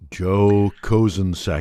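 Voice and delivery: low, slow spooky ghost voice; ghostly voice. Pretend. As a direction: drag the name out like a ghost. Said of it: "You can say any name in a ghostly voice".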